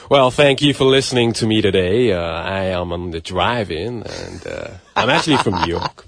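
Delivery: put-on New York American accent; mock American radio host; American accent, hammed up. Shown as a demonstration. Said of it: "speak with an American accent?".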